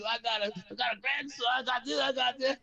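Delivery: imitating souvenir grabber. Mimics man grabbing table souvenirs.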